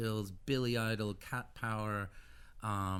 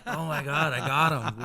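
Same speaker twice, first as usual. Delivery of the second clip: louder, laughing thrill; laughing delight; 5 semitones higher, 10 dB louder than usual. Retold or imitated. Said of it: "I was like".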